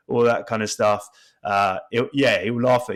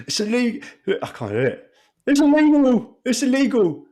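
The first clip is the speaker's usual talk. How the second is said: pundit impression; 13 semitones higher than usual. Friend copying the pundit raving about a goal. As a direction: rave like a TV pundit. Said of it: "the spoil was in the studio".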